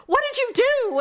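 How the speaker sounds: high-pitched mock alarm; panicked accusation. Husband waking up confused beside his wife, panicked accusation.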